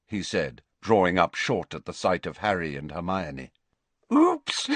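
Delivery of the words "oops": very high, giggly little exclamation; giggly girl. A narrator's reading. Lavender, caught walking in with Ron, giggly high squeak.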